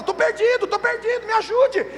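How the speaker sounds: mock plea of a stray sheep; lost sheep crying out. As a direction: call out high like a lost lamb. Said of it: "ela grita, ela fala".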